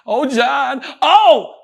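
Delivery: high, loud climax cry; ecstatic cry. Retold or imitated. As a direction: let it burst out as the climax. Climax of Red Fox joke.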